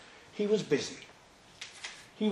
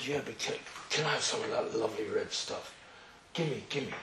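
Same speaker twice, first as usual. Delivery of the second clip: greedy wheedling; 6 semitones lower, 11 dB quieter than usual. Retold or imitated. Esav begging his brother for the red stew, greedy and wheedling. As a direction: lower and softer, a greedy wheedle.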